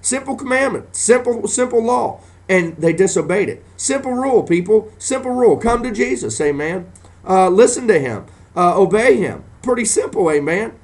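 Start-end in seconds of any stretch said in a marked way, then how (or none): 0.0-6.4 high, ringing preacher voice, pitched way up; rising preacher's cry; fiery preaching. Acted. Preaching climax. 7.3-10.3 high, ringing preacher voice, pitched way up; rising preacher's cry; fiery preaching. Acted. Preaching climax.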